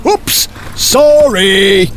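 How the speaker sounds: very drawn-out mock sorry; slow comic oops; sheepish apology. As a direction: stretch it out like an embarrassed blunderer. Viking leader realises his blunder.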